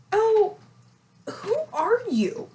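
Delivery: high, loud puzzled voice; squeaky who-are-you; high confused self. Retold or imitated. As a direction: play your past self, baffled and high. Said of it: "And I was like".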